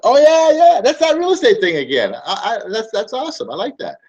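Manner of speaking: very high, loud gushing customer, softening at the end; mock customer. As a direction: burst out as the thrilled buyer, then trail off. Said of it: "customer reminded again".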